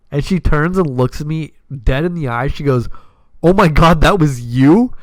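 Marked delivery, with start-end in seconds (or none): 3.4-4.9 surprised. Retold or imitated. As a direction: react like you just met the guy from the story. Lexi shocked he drove dad.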